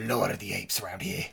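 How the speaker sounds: very low, quick, soft growly boast; mock tough-guy Tarzan. Podcaster playing Statham's Tarzan, low mock boast. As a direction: claim it low and fast like an action hero.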